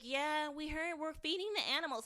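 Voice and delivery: high-pitched mocking imitation of the kids; mimicked kid reply. Kids brushing off dad's yelling, mocking imitation. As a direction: lightly mimic an unbothered kid. Mimicking the kids' casual answer.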